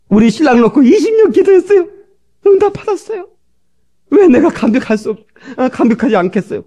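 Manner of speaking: wife telling the pastor her prayer was answered, high and overcome; tearful wife's words. Retold or imitated. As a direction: raise your voice high like a wife overcome with joy. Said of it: "막 울어. 펑펑 울어".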